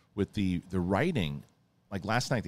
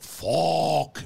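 frustrated curse; 7 semitones higher than usual. Retold or imitated. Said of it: "And Silvio's like".

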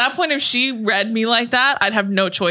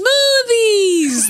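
very high, drawn-out comic yell; mock shriek; 13 semitones higher, 4 dB louder than usual. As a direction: belt it out in a hammed-up imitation. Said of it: "That's what she says".

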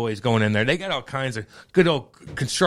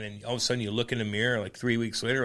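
ordinary delivery

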